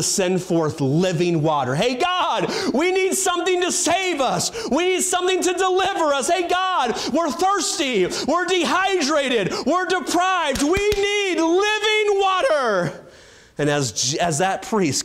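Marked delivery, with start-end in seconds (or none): 1.7-13.0 desperate cry to God. Acted. Thirsty crowd crying out to God, high desperate shouts. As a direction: cry out to heaven for rescue. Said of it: "he watches them cry out to God".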